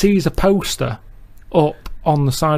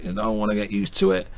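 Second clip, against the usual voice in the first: quiet moan; 7 semitones lower, 9 dB quieter than usual.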